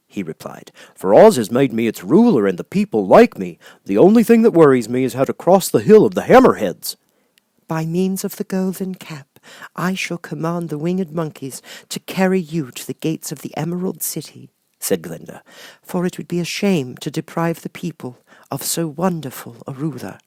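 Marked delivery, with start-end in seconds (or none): 0.9-7.0 loud, odd straw-man voice; a cheerful floppy scarecrow speaking up. Acted. Scarecrow telling Glinda his plans, in a loud odd straw-man voice. 7.7-14.5 high, gentle sorceress voice; kind witch Glinda. Acted. Glinda promising Dorothy a way home, high and gentle. 15.8-20.2 high, gentle sorceress voice; kind witch Glinda. Acted. Glinda promising Dorothy a way home, high and gentle.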